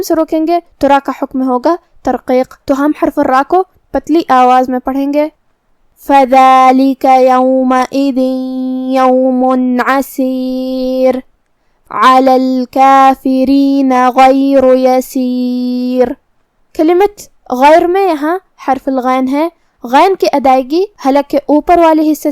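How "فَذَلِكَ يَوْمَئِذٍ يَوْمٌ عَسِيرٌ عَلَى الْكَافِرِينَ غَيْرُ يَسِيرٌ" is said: very slow chanted tilawat; Quran recitation. Acted. Teacher re-reciting the verses for her students, slow chant. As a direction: draw each word out in a measured chant.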